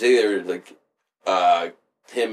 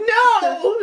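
very high imitated cry; mock girlish protest; 4 dB louder than usual. Retelling the girl's answer to Al, high mock protest.

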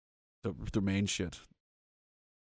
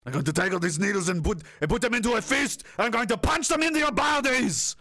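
Arnold Schwarzenegger threat; 4 semitones higher than usual. Retold or imitated. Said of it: "Arnold Schwarzenegger said that".

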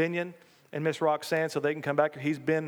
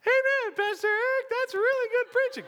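very high falsetto, louder; mock congregant; 3 dB louder than usual. Pastor jokingly praises his own preaching as congregant, mock falsetto. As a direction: squeak out playful praise in a put-on voice. Voices an admiring congregant himself.

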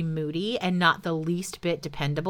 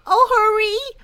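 much higher, urgent dragon cry; pleading dragon; 6 dB louder than usual. Acted. Dragon urging the father to hurry, high and pleading. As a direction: beg him to hurry. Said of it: "the dragon kept saying".

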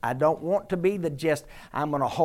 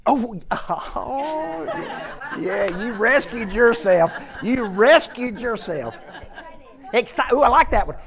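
laughing; 7 semitones higher, 5 dB louder than usual. Real, not acted. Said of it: "laughing at congregant's answer".

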